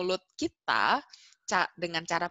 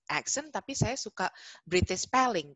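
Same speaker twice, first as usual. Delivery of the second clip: ordinary talk, nothing marked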